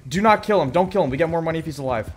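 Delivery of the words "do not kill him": normal talking voice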